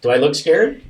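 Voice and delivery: loud, high, overdone denial; bluffing bravado. Retold or imitated. Dad hiding his fright from his young daughter, overdone bravado. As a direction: cover fear with loud mock confidence.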